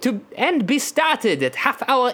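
laboured, thick mock French accent; exaggerated French accent. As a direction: explain it as a caricature French official. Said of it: "I really enjoy how uncomfortable it is".

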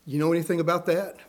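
ordinary talk

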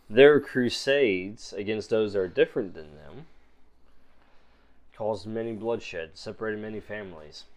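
a guarded stranger weighing her words; quiet suspicious woman; mysterious stranger. Acted. GM voices stranger.

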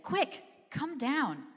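high, loud, drawn-out summons; calling out. A narrator's reading. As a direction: call someone down with urgency, stretching the words. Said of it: "called him by name".

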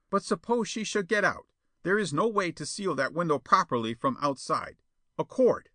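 high-pitched, put-on plotter voice; worried schemer. A narrator's reading. Perona worries Jetta may escape, scheming. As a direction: fuss over the risk, then seize an idea.

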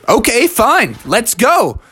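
fired-up, slightly exasperated acceptance; taking up the challenge. Acted. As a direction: rise to grandma's challenge. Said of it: "and he said".

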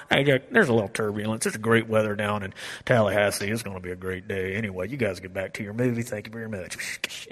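relaxed pilot reassuring the cabin; calm pilot announcement. Retold or imitated. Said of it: "waiting for the pilot to".

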